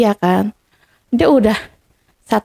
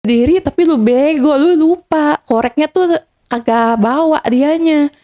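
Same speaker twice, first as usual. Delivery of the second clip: higher, teasing scold on the verge of laughing; mocking, holding back laughter; 5 semitones higher than usual. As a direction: tease her for forgetting the lighter, trying not to laugh. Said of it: "Mau ketawanya gini". (mengejek sambil menahan tawa)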